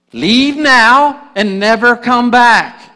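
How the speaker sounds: high, loud, slow command; banishing command. Acted. Smeagol banishing Gollum, high, loud and slow. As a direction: cast him out with a slow, ringing order.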